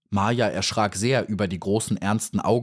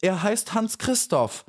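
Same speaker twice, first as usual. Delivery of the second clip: tearful; 13 semitones higher than usual. A narrator's reading. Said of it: "in ihre Augen kamen Tränen".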